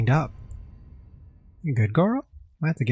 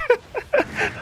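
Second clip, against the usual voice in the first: shrieking laugh. Real, not acted. Ox cart mishap.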